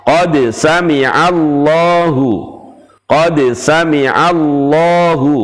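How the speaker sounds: heavy rounded lah in sami'allahu; sami'allahu with a full dark lah; thick lah. Shown as a demonstration. Teacher recites a long example, heavy lah. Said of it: "Contoh yang panjang".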